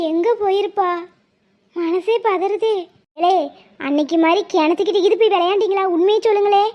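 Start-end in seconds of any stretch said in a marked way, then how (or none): none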